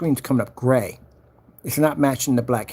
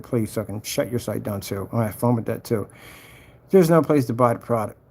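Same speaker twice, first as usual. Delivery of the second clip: ordinary talk, nothing marked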